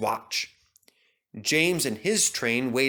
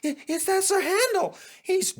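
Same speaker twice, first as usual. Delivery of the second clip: high frightened stutter; stammer, trying to tell tales. Acted. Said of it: "stammered the coaches".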